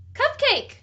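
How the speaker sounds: high, loud, eager answer; prompting the kids. Shown as a demonstration. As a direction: call out the missing word for the children. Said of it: "can you say it?".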